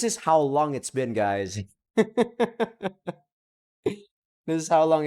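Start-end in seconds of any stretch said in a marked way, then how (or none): 1.9-3.1 big laugh. Real, not acted. Laughs at forgetting the game.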